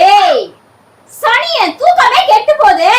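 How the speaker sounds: loud, high furious yell; angry shout. Real, not acted. Wife yelling at husband for ruining her sleep, angry shout. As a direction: yell at him for waking you. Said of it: "இப்ப எதுக்கு கத்துற?".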